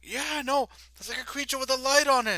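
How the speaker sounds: high, loud, fast guard chatter; excited guard. Acted. Guard explaining the creature to his comrade. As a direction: rattle it off, keyed up.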